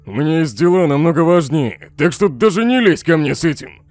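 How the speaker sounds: loud, irritated rebuff; indignant refusal. Acted. Indignant refusal in acted quarrel.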